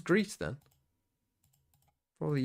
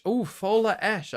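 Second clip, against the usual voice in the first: sudden recognition; 6 semitones higher, 5 dB louder, slower than usual. Real, not acted. Recognises the club name.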